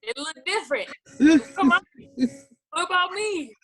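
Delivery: lightly voiced disbelief, rising high; retold protest. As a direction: replay his own indignant question, half-joking. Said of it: "And I'm like".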